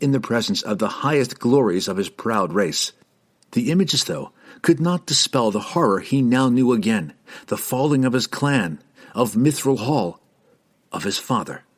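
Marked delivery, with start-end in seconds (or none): none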